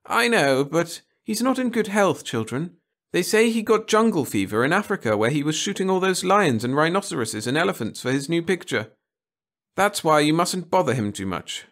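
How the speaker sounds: high, loud teacher voice; schoolteacher to class. Acted. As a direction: call the class together on a trip. Teacher explaining.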